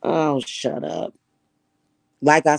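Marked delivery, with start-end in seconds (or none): none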